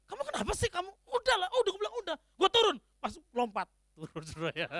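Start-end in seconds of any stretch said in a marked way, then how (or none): 0.1-2.8 put-on fed-up girlfriend. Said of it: "Udahlah, akhirnya marah".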